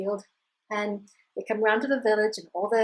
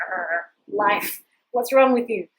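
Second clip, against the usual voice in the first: mock growl of frustration, rising louder; exasperated outburst; 6 dB louder, slower than usual. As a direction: grumble loudly at life, acted out. Old man laments his lost horse.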